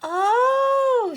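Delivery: very high, loud, long cry; shouted. A narrator's reading. Girl spotting her lost robot, astonished shout. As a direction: squeal out in astonishment. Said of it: "she shouted".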